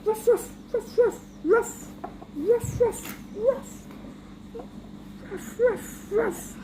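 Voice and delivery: high yapping barks; barking dog. Acted. The dog barking high at the doorbell. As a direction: yap like an excited little dog.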